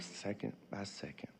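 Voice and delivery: hushed, breathy whisper. Real, not acted. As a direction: whisper it through grief. Grieving father, hushed confession.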